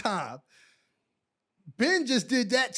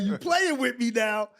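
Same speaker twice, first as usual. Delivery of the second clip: retold anger; 3 semitones higher, 4 dB louder than usual. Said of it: "So now I'm mad".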